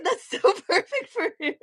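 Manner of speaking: real high laugh into the words; giggling with delight. Real, not acted. Artist laughing about the voice fitting Red Wing.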